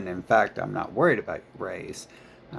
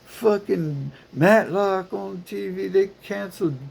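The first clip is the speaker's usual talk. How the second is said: mocking petty complainer; 10 semitones higher, 5 dB louder than usual. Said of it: "it's always like".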